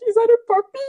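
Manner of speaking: high, loud mock-amazed question; exaggerated high-pitched imitation; Teresa impression. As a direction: squeal it like clueless wonder. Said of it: "I kept crying when she was like".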